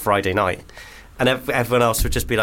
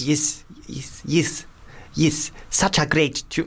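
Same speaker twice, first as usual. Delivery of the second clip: put-on South African accent, pitched up; Morgan Freeman in Invictus; 4 semitones higher, slower than usual. Host tries to get it back, South African accent. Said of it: "Think about Morgan Freeman in Invictus".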